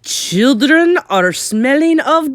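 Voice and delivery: slow, high, loud witch screech; high screeching tirade. A narrator's reading. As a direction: screech it at the crowd, drawing out words. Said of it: "screeched the Grand High Witch".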